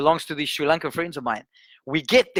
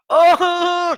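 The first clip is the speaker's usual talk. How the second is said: high, louder retold wail; imitated bawling; 12 semitones higher, 7 dB louder than usual. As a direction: cry out as she breaks down. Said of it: "this girl starts bawling".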